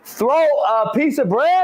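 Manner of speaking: slow, high-pitched puzzled question; incredulous mishearing. Real, not acted. Co-host questioning his partner's diction, slow incredulous mishearing.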